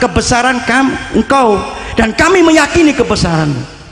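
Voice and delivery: high, fervent supplication; earnest prayer. Acted. The saint praying to calm the storm, fervent and high. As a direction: pray with awe to God.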